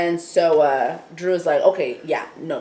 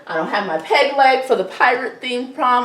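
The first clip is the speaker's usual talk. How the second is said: fast, rising, higher panic over prom; hammed-up frantic Allie; 6 semitones higher, 4 dB louder, faster than usual. Allie panicking to Dallas about prom, mock frantic. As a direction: fuss like a stressed prom queen. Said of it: "Allie's like".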